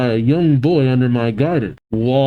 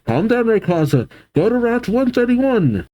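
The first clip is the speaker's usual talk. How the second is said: raised pitch, commanding plea; urgent command; 9 semitones higher than usual. Acted. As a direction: act out ordering a beast to calm. Ruby commands the dragon.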